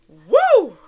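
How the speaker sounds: sudden high, loud whoop; loud woo. Real, not acted. Podcaster venting to listeners about a crazy time, loud whoop. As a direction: let out a big "woo!". Exhales loudly mid-update.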